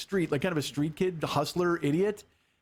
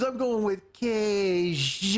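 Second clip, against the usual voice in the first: very slow, drawn-out tough-guy line; Dice's way of talking; much slower than usual. Retold or imitated. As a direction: stretch every word like a smug wiseguy. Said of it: "You know the way he talks?".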